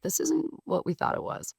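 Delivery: high whispered whine imitating the clients; mock annoyed clients. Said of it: "the people were getting pissed off 'cause they're like".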